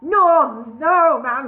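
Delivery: high, loud, slow villain croak; loud horrid goblin king. A narrator's reading. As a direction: gloat over the beaten enemy. Cluck refuses.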